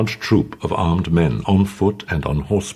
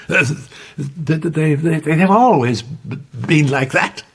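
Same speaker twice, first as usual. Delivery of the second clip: frightened stuttering reply; stammering; 12 semitones higher than usual. A narrator's reading. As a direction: stumble over every word. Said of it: "she stammered".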